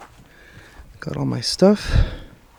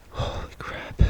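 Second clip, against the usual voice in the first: whisper; 11 dB quieter, slower than usual. Real, not acted. Alone in spooky woods at night.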